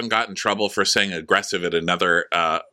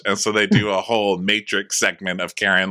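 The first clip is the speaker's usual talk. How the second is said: usual speaking voice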